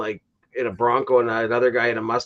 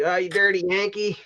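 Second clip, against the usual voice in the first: mock yelling; 7 semitones higher than usual. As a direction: mimic someone yelling an insult, at normal volume. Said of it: "yelling at me".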